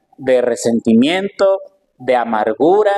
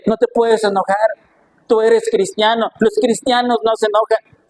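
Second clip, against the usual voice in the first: mocking scold; 7 semitones higher than usual. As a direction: mimic the scolders with scorn. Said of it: "Y le dice".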